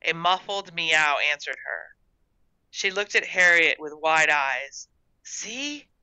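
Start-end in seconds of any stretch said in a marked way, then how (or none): 5.2-5.9 high, hushed little voice; whispered. A narrator's reading. Gertrude hears the cat answer, whispering. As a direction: hush it excitedly to your sister. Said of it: "she whispered".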